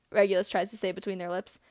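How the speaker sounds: usual speaking voice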